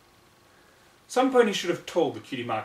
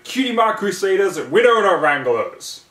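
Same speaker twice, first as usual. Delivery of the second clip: shouted in unison; 7 semitones higher, 11 dB louder than usual. Acted. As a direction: yell it out like a team chant. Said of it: "they shouted".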